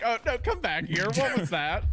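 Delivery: mock alarmed husband. Imagining reaction to creepy wife.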